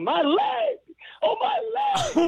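high-pitched mock scream of pain; retold scream. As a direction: wail it high and drawn out, imitating an injured woman. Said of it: "She's screaming at the top of the lung".

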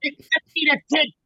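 high cartoon villain voice, excited; Penguin voice. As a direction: imitate the thrilled coach in his Penguin voice. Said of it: "he spoke like the Penguin".

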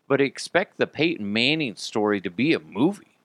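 ordinary talk, nothing marked

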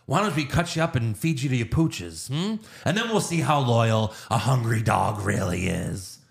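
theatrical villain threat, sinking in pitch; Joker. Retold or imitated. Villain threatening a gangster with his own dogs, mock-menacing. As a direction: toy with the victim, drawing out the end. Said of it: "then Joker's like".

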